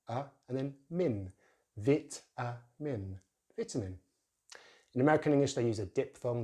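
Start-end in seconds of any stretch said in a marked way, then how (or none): 3.6-4.0 vitamin with short vi; British vitamin. Shown as a demonstration. Teacher demonstrating British vitamin. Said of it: "vowel sound they use in the first syllable".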